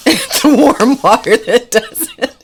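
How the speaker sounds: the punchline breaks into laughter; giggling while quoting the lord; can't hold back a laugh; laughing through the quote. Retold or imitated.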